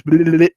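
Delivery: mocking bleh. [name] making faces at the first baseman, mocking bleh. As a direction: blurt a silly tongue-wagging noise. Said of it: "making faces again".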